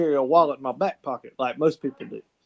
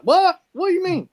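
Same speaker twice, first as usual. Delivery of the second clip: mock shocked yelp; shocked what. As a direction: yelp it out in disbelief. Baffled at the bartender's warning.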